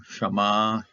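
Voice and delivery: Shama with the last syllable lengthened; Shama, accent landing on the end; stress on final syllable. Shown as a demonstration. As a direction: say Shama as sha-MAA, slowly. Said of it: "There's the accent right here".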